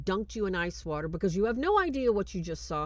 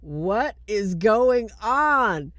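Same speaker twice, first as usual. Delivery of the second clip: loud, drawn-out cry of disbelief; hammed-up freak-out, very slow and loud; 3 semitones higher, 11 dB louder, much slower than usual. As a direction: stretch every word in loud alarm. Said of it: "He is freaking out".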